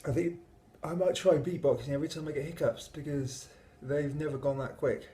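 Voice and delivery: ordinary delivery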